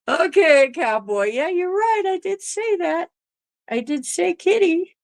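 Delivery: very high, indulgent pet voice; pet talk. Real, not acted. Owner conceding to her cat Cowboy, high pet-talk voice.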